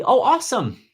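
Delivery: real, upbeat pleased surprise; pleasantly surprised. Real, not acted. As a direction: react with real delight to good news. Delighted reaction to a viewer's comment.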